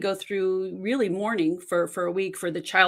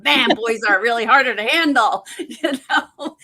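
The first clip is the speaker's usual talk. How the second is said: laughing; 4 semitones higher, 6 dB louder than usual. Retold or imitated. Laughing at own past naivety.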